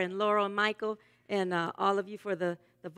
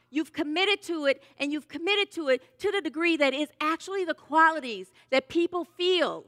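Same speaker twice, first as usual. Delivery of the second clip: raised, emphatic sermon voice; impassioned preaching; 5 semitones higher, 4 dB louder than usual. Real, not acted. Minister praising the congregation's commitment, impassioned preaching. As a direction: preach it with conviction, pitch lifted and phrases punched.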